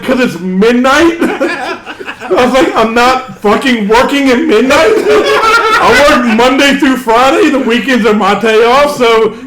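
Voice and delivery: can't keep a straight face while retorting; laughing through it. Real, not acted. Retelling his comeback to the teens, laughing.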